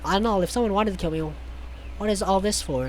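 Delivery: usual speaking voice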